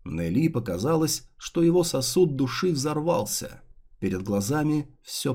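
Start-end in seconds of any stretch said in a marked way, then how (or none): none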